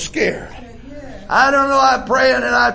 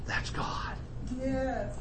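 hushed whisper; 4 semitones higher, 18 dB quieter, much slower than usual. Acted. Hushed reverent aside.

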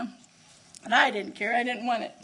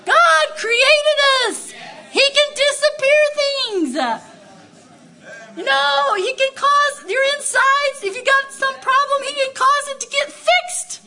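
much higher and louder, shouting praise; full-force preaching; 10 semitones higher, 8 dB louder than usual. Real, not acted. Preacher shouts God's power to the congregation with fervor. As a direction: preach it out with fire.

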